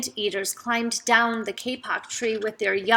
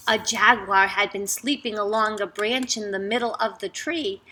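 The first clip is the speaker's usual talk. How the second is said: ordinary delivery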